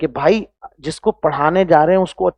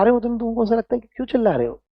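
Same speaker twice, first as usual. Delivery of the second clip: mock know-it-all student; 4 semitones higher, 4 dB quieter than usual. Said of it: "बच्चों का attitude ऐसा होता है".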